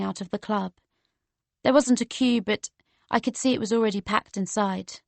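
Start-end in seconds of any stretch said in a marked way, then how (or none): none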